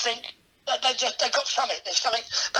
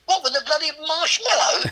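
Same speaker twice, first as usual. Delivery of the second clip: higher-pitched, incredulous comeback; retold protest, pitched up in disbelief; mock indignation; 6 semitones higher than usual. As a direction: throw it back as a mock protest. Retold indignant reply to colleague.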